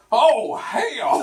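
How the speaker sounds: sudden loud, high-pitched holler; hollered exclamation. Retold or imitated. Storyteller imitating a friend's outburst at dinner, hollering. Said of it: "Bill all of a sudden hollers".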